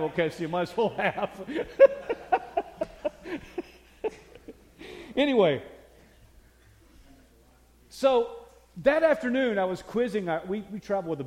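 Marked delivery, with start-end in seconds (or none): 1.4-5.1 high airy laugh at his own joke; preacher laughing at his own weak joke; breathy laugh. Real, not acted.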